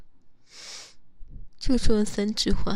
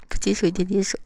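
ordinary talk, nothing marked

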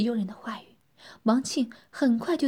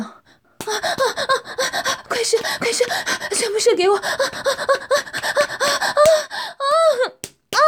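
much higher, louder pleasure cries; crying out; 7 dB louder, much faster than usual. Acted. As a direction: shout out in pleasure. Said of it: "夏丽晶舒爽的大叫". (舒爽地大叫)

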